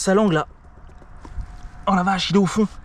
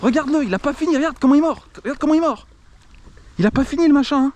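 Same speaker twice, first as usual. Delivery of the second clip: excited urging; 7 semitones higher than usual. Real, not acted. Huge catfish biting on the line.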